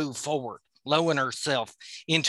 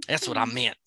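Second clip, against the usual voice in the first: plain reading voice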